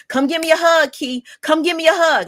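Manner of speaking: high, loud imitation of mom pleading; insistent mother. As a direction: call out for a hug as an insistent mom. Voices mother repeating her plea.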